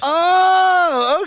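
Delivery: long, high, loud "oh"; drawn-out rising excitement; delighted surprise. Real, not acted. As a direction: react thrilled to an unexpected treat. Thrilled at surprise live prompt.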